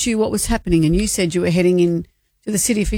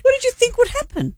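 incredulous. Real, not acted. Cohost reacts in disbelief.

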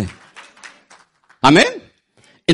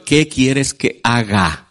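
lower, very slow humble question; humble prayer; 7 semitones lower, much slower than usual. Acted. Believers asking the Lord, low slow humble plea. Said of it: "No le preguntamos al Señor".

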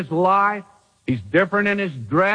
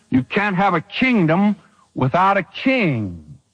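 usual speaking voice

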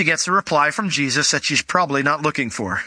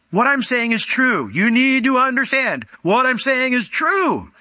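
emphatic paraphrase; 8 semitones higher than usual. Shown as a demonstration. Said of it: "They would repeat it for emphasis".

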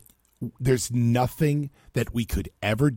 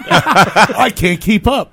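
laughing; 9 semitones higher, 10 dB louder, much slower than usual. Real, not acted. Laughing at station mixup.

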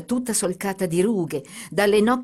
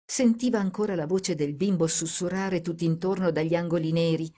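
plain reading voice

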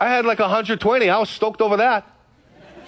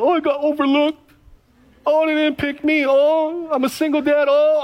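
mock self-pity; 7 semitones higher than usual. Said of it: "I could have lived in my feelings".

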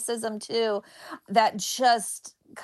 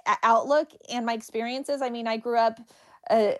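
ordinary delivery

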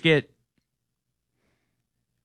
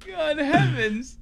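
high, slow, prissy British exclamation; a toff clutching his pearls, in British; mock British accent; 13 semitones higher, 4 dB quieter, slower than usual. Repeating the British joke to chat in a high British voice. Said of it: "British men say".

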